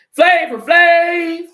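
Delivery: drawn-out, high, loud hype call; hype shout. Pretend. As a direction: yell it like a hype man. Shout-out in [name] style.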